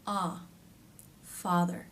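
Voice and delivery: "Ah" and "father" with the tongue tip forward and the jaw dropped; soft, wide-open "Ah, father"; open ah demo. Shown as a demonstration. Teacher modeling the vowel again, softly, jaw open. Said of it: "lightly touching just behind the bottom teeth".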